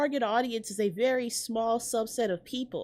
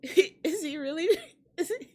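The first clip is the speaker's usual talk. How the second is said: laughing disbelief; 6 semitones higher than usual. Real, not acted.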